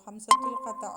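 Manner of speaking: ഹംസത്തുൽ ഖത്ത called out loud, falling at the end; emphatic term. Shown as a demonstration. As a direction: punch the term out loud for the class. Teacher stresses a key term. (ഊന്നി ഉറക്കെ പറയുന്നു)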